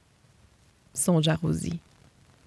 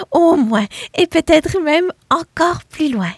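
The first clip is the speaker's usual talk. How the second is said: rising higher and louder with excitement; excited dream; 8 semitones higher, 4 dB louder than usual. Acted. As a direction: build up to a dreamy excited high. Dreaming of riding far.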